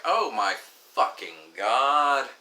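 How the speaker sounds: very slow, dragged-out oh my fucking God; exasperated groan. Retold or imitated. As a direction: stretch it out in utter disbelief. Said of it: "And I'm just like".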